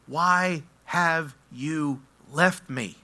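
very slow, word by word; halting, heavily weighted plea; forsaken plea. Acted. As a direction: draw out each word of an anguished question to God. Acts Jesus' forsaken prayer.

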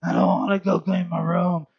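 put-on sulky child's whine; whining kid. Pastor mimics his kids whining about cleaning, lightly voiced. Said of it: "what my kids do".

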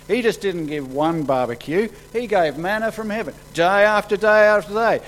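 loud, high-pitched acted one-upmanship; insistent boasting. Crowd holds up Moses against Jesus, boastfully insistent.

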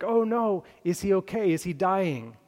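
high, loud panicked gasp of worry; alarmed daughter. Retold or imitated. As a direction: blurt it out like bad news just hit. Daughter hears father had heart attack.

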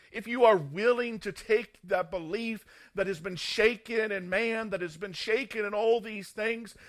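forceful sermon plea, voicing Jesus' call; impassioned preaching.

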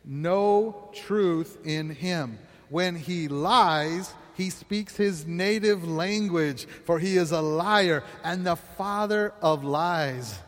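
slow, measured scripture recital, word by word; slow group recitation. Shown as a demonstration. Preacher leading the congregation in the verse, slow recital. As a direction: lead the room through the verse slowly. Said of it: "Can we say that again?".

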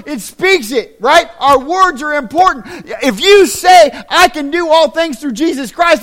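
loud, high, driving sermon voice; fiery pulpit delivery; full-force preaching. Acted. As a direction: preach it hard to the congregation.

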